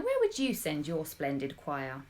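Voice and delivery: plain reading voice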